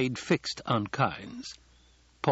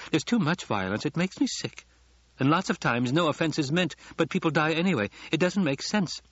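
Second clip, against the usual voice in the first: put-on higher-pitched, artless young girl; girlish chatter, pitched up; empty-headed girl act; 7 semitones higher than usual. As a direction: play the naive little miss. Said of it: "that empty-headed little female".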